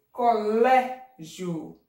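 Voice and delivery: Colégio very slow, loud open LÉ. Shown as a demonstration. Teacher showing open sound, Colégio with long LÉ. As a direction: stretch and punch the open LÉ in Colégio. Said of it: "É um som aberto".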